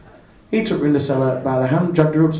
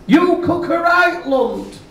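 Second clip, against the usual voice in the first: mocking shout; 12 semitones higher, slower than usual. A narrator's reading.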